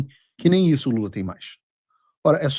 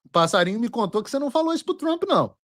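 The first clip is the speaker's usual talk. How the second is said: high, quick sing-song tease; teasing taunt; 7 semitones higher, faster than usual. Real, not acted. Host teasing the president with insider gossip, high mocking tone. Teases with a little bird's tip.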